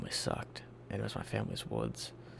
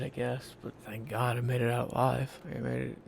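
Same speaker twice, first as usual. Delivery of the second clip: ordinary talk, nothing marked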